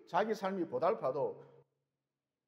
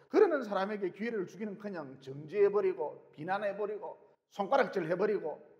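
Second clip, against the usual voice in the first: ordinary talk, nothing marked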